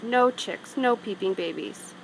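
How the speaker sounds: ordinary delivery, nothing marked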